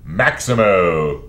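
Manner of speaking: loud, slow, stretched-out shout; drawn-out shout. Acted. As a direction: belt the name out slowly. Shouting the name slowly.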